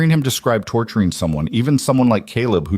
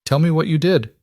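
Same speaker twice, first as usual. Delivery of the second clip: voice cracking; 8 semitones higher than usual. A narrator's reading. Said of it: "Ava's voice cracked".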